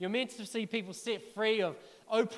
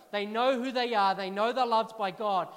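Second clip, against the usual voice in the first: usual speaking voice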